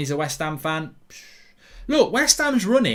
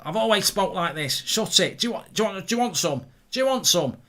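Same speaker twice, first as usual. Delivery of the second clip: mock-confrontational Mancunian lad, pitched up; Manchester accent; 5 semitones higher than usual. Teenage self challenged about his talk, Manchester accent. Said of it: "What are you talking like that for?".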